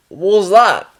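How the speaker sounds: loud, startled Australian patient; Australian accent. Acted. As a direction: wake up asking in an Australian accent. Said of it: "He had the funny accent".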